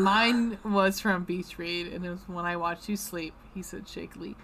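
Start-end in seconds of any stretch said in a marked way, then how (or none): 2.3-4.3 soft, quiet book quote; reading aloud. Acted. Host reads a tender line from Beach Read, softly.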